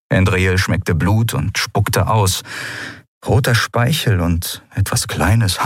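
ordinary delivery

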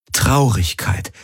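ordinary delivery, nothing marked

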